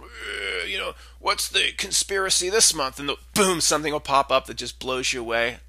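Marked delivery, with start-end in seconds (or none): 0.0-3.0 a mock old-timer voice, much higher; imitating old man. [name] as an old man asking for conspiracies, mock elderly voice. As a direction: play yourself at seventy-five, weary and creaky-free. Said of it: "seventy-five years old".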